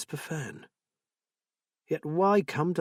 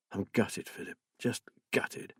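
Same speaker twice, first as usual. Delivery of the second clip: softer, half-breathed despair; gutted, breaking up; 2 semitones lower, 8 dB quieter than usual. Acted. Will confessing to Philip, voice breaking softly. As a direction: let the voice drain away. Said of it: "wailing to you like a baby".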